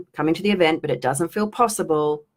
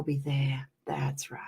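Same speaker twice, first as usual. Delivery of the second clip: hushed trance whisper; 3 semitones lower, 11 dB quieter, much slower than usual. Acted. Coach soothing viewers at the end of the release, whispered. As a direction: breathe the words out softly.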